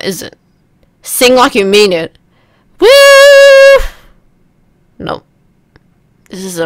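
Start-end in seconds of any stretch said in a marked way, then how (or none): 2.8-3.8 very high, loud sustained whoop; long high whoop. Pretend. Player pushing pitch up for the game, long loud whoop. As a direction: hold one piercing high whoop.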